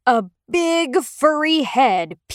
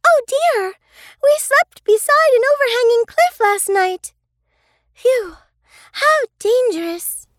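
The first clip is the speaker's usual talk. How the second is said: put-on high, louder frightened child voice; alarmed girl; 12 semitones higher, 4 dB louder than usual. Sisters discovering the cliff edge, high alarmed voice. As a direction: gasp at the danger you escaped. Girls realise they slept by cliff.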